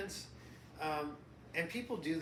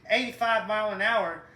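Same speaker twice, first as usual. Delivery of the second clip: put-on light Southern country accent, a little louder; 3 semitones higher, 9 dB louder than usual. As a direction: play a good-ol'-boy Southern mechanic named Jimmy. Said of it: "started talking with a southern accent".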